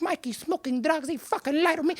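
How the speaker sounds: stewing anger. Acted. Comic's mother stews alone before he comes home, quiet seething. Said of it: "in her anger".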